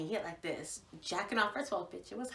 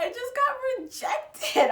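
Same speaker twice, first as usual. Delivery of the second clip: mock devastated; 6 dB louder than usual. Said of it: "And I was like".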